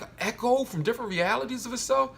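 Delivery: ordinary talk, nothing marked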